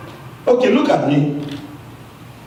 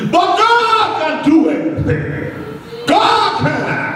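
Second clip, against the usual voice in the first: excited proclamation; 8 semitones higher than usual. Real, not acted. Joyful proclamation after laughing.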